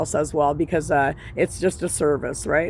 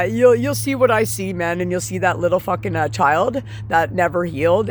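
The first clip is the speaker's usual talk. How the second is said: ordinary talk, nothing marked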